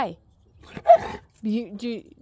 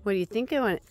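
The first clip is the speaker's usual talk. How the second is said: ordinary talk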